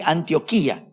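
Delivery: Antioquía with the stress on í; accent on the I. Shown as a demonstration. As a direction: say Antioquía as an-tio-KEE-a, í as its own syllable. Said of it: "con tilde en la I".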